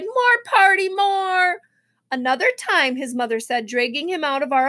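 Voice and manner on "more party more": put-on a little kid's pleading shout; crying out. A narrator's reading. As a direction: beg to stay like a small boy. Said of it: "He cried".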